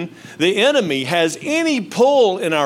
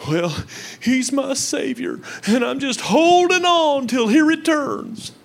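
sincere believer voice; 10 semitones higher, slower than usual. Retold or imitated. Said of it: "Who do you say Jesus is".

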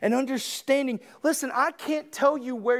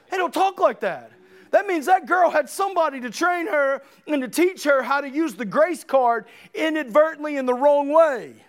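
raised, louder, indignant sermon delivery; forceful preaching; 4 semitones higher, 5 dB louder than usual. Real, not acted. Preacher objecting to how the girl was taught, forceful preaching. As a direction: drive the rebuke home from the pulpit.

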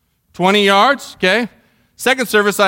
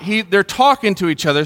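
ordinary talk, nothing marked

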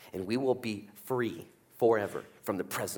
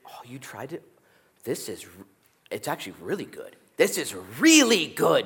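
gentle father praise; 3 semitones higher than usual. Acted. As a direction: coo it like a proud dad. Father praising child's drawing.

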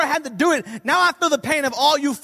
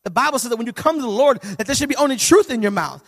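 usual speaking voice

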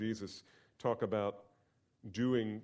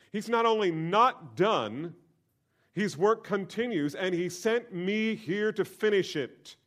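louder, higher, slowed rhetorical questions; fervent preaching; 8 semitones higher, 8 dB louder, slower than usual. Acted. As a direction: press the congregation with rising questions. Preaching climax.

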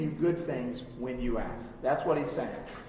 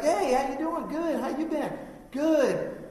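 much higher, stilted reunion greetings; mock an awkward exchange in a high voice; awkward small talk; 10 semitones higher, slower than usual. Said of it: "there were some awkward conversations".

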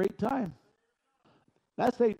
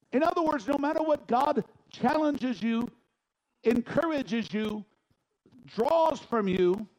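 loud, higher fervent sermon; preaching at full force; 7 semitones higher, 4 dB louder than usual. Acted. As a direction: press the congregation hard.

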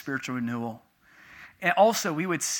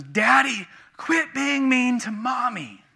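put-on higher-pitched child telling Daddy off; imitated scolding daughter; 8 semitones higher, 6 dB louder, slower than usual. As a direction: plead like an upset little girl. Voices daughter scolding her father.

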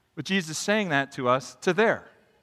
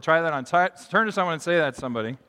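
usual speaking voice

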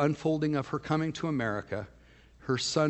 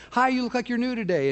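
bright friendly greeting; 11 semitones higher, 7 dB louder than usual. Retold or imitated. Pastor greeting a new church visitor, cheerfully high and loud. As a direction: voice jumps much higher and louder in welcome.

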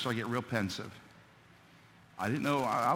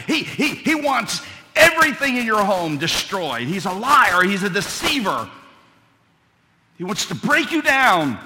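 loud, raised preaching about the devil; preaching at full force; 8 semitones higher, 10 dB louder than usual. Acted. Preacher warning the congregation about the devil, raised preaching. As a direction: hammer the warning home from the pulpit.

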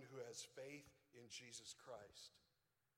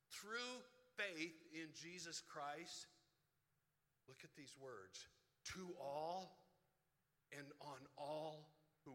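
slow, loud reading of the verse; scripture reading; 3 dB louder, slower than usual. Acted. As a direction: lay out each word of scripture slowly. Reads Romans aloud from pulpit.

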